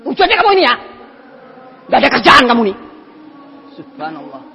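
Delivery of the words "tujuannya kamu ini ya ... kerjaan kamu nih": fast, louder, angry rant; a man rejecting his friend's gifts and advice, fast and angry; angry outburst. Retold or imitated. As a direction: snap at the friend who keeps helping. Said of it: "marah lagi dia".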